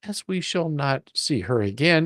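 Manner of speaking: ordinary delivery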